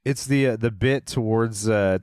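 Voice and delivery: usual speaking voice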